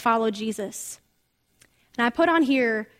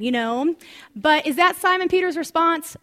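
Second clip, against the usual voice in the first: ordinary talk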